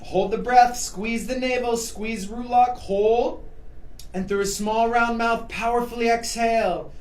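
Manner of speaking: energetic instructor cueing. Acted. High-energy yoga cueing over music.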